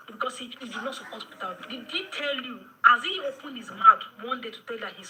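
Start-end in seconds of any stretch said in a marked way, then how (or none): none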